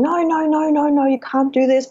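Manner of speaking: high, panicked no no no; the ego protesting in a high voice. Retold or imitated. Speaker voicing her ego refusing the new partner, high and insistent. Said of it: "The ego is saying".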